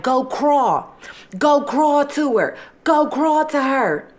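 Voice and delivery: high-pitched, insistent mock command; high, goading imitation; imitated taunt. Acting out her taunting the dying man.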